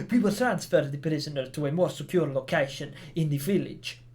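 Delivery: badly faked Spanish accent; attempted Spanish accent. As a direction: read it in an amateur's Spanish accent. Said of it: "I should be using a Spanish accent".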